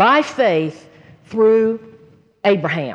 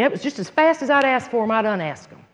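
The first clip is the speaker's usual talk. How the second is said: usual speaking voice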